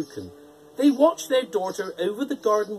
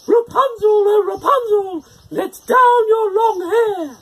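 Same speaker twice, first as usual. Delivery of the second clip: witch calling out; 9 dB louder than usual. A narrator's reading. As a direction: call up the tower like a witch. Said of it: "called out".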